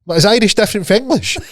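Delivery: high-pitched puzzled question; retold confusion. Friend asking Dave about the Irish language, confused. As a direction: play up the bewilderment, voice high.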